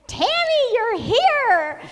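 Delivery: high, loud imitation of thrilled officers; big retold shout of welcome; excited greeting. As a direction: call out as if she's finally arrived. Officers greet her in amazement.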